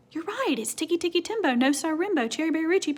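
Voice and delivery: usual speaking voice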